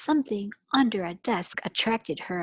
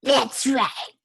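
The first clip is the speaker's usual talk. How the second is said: higher, loud stern teacher; stern witch; 5 semitones higher, 5 dB louder than usual. Acted. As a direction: say it with menace. Voices Miss Swamp.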